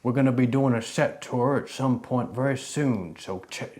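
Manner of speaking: high cowboy outro voice. Shown as a demonstration. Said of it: "do the outro and the cowboy voice".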